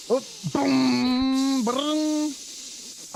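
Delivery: vocal explosion sound. Pretend. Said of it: "we both do the sounds".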